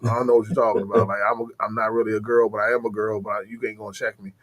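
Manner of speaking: mock deep, low monotone replies; low-pitched dude-voice parody; Brittany Griner impression. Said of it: "that motherfucker's voice is just".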